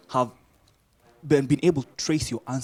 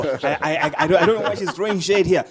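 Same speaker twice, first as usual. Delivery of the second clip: laughing through the words, louder; 6 semitones higher, 10 dB louder than usual. Real, not acted. Host laughing off a guest's shade. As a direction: brush off the jab with a laugh.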